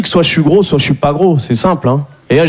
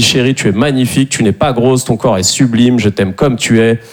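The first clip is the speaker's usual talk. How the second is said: reassuring; 8 semitones lower than usual. Retold or imitated. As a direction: soothe her gently. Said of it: "Je lui dis".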